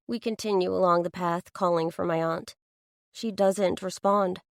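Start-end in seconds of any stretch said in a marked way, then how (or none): none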